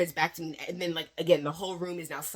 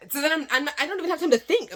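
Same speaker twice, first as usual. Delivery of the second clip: flustered panic; 10 semitones higher, 6 dB louder, faster than usual. Real, not acted. Retelling the panic of the moment.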